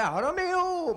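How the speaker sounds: very high, long balcony call; hammed-up Juliet crying out for her lover. Juliet back on the balcony fifteen minutes later, high theatrical call. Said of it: "Elle sortait".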